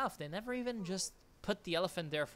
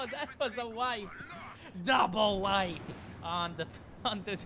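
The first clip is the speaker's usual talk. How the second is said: excited; 6 semitones higher, 5 dB louder than usual. Real, not acted. Exclaims at double wipe.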